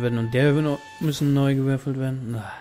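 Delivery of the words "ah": ordinary delivery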